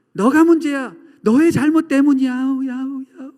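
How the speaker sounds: loud, high yell trailing into echoes; mock echo fading off on the last syllable; accusing shout echoing back. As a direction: imitate your angry shout bouncing back. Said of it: "나에게 하는 소리라는 것입니다".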